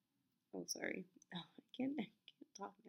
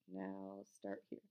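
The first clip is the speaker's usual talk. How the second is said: usual speaking voice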